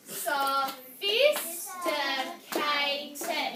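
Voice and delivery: SOPHISTICATED very slow, each syllable apart; loud, slow teacher model of SOPHISTICATED. Shown as a demonstration. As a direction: break SOPHISTICATED into five separate beats. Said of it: "Let's clap it out".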